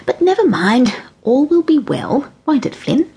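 a hint of a kindly granny reassuring; light granny voice talking to the cat; lightly voiced cheerful granny.

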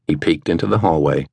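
ordinary delivery, nothing marked

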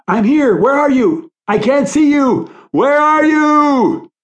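high, frantic shouting, last words drawn out; panicked high-pitched calling; terrified cry. A narrator's reading. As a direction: shout desperately for someone unseen. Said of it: "the terrified cry".

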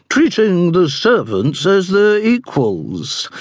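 slow, high, disdainful matron voice; haughty dowager duchess. A narrator's reading. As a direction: drawl it like a scornful duchess.

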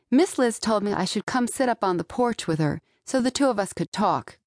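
plain reading voice

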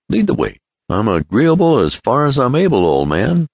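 obliging chum, joking, high and lively; Ned. Acted. Ned jokingly agrees to anything.

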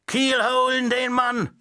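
sailor's shout. Acted. The sailor puppet demands punishment, shouting high. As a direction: bawl it like an old sailor. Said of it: "rief der Schiffer".

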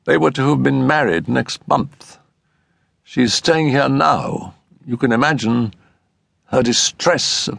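high character voice, slowing with feeling; grave news, mildly moved, slowing at the end; touch of emotion. A narrator's reading. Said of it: "lowering his voice with a touch of emotion".

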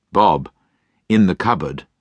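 ordinary delivery

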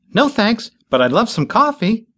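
put-on high, hurried young woman; high fidgety reply. A narrator's reading. Bitsy jumping in place.

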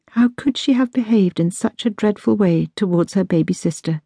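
usual speaking voice

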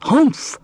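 high grumpy snort; dismissive camel grunt; camel humph. Acted. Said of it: "said the camel".

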